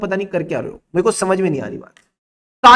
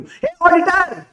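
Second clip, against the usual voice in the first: mock outraged cry; 7 semitones higher, much slower than usual. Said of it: "कहती".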